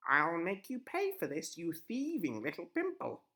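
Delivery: high, loud witch threat; menacing old witch. A narrator's reading. Witch voiced.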